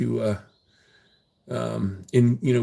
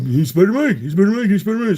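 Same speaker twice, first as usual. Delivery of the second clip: mocking Shane; 8 semitones higher, 5 dB louder, faster than usual. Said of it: "Shane's all, you know".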